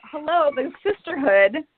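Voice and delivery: normal talking voice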